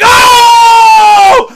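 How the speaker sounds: very high, long, loud wail; reenacted fanboy shriek; hyped scream. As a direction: scream like the fight just peaked. Reenacting reaction to the attack.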